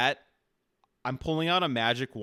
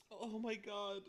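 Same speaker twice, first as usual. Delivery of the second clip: high, very soft leftover laughter; spent from laughing; 8 semitones higher, 12 dB quieter, slower than usual. Real, not acted. Listener recovering from the Rasta act, weak with laughter. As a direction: weakly squeak it out after cracking up. Winded after laughing fit.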